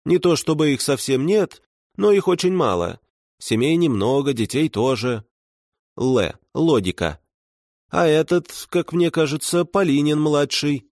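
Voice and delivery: a lightly voiced chatty old babushka; old woman voice. Voices Baba Masha.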